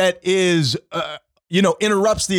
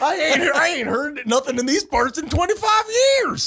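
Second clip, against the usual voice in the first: hammed-up drawling yokel, laughing, higher; hillbilly voice; 9 semitones higher than usual. Mock yokel answering the cops, laughing. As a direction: play the backwoods local who saw nothing, laughing.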